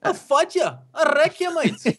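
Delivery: very high-pitched mock Aussie threat; squeaky-high, hammed-up Australian; terrible Australian accent. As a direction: badly fake an Australian picking a fight, pitched right up. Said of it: "for that terrible impression".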